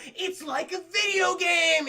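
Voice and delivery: high, slow, dawning excitement; mock excited realisation. Luigi works out the car controls, high mock excitement. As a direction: stretch it out in gleeful mock discovery.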